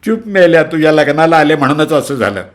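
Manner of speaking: high, sharp furious outburst; tearful rage. Acted. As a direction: snap at him through tears. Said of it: "अश्रुमिश्रित संताप".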